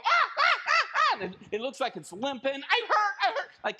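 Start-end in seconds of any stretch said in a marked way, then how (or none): 0.0-1.2 high, loud mock squawks of pain; faking hurt bird cries. Bird luring people from its babies, hammed-up cries of pain. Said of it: "bird's going around going". 2.5-3.5 high, loud mock wail of pain; faking hurt bird. Bird distracting people from its babies, hammed-up hurt. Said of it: "The bird was faking it".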